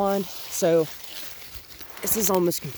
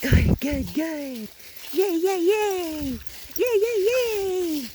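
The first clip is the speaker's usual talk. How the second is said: much higher, louder yays; excited cheering; 11 semitones higher, 4 dB louder, much slower than usual. Real, not acted. Trainer cheering the puppy on with yays. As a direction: cheer like she won a prize.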